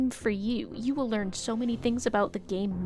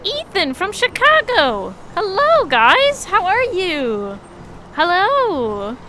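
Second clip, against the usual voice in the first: much higher and louder, bubbling welcome; excited greeting; 8 semitones higher, 6 dB louder, slower than usual. Real, not acted. Streamer greeting viewer Ethan from Chicago, excited and loud. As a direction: throw a big welcome to the chat.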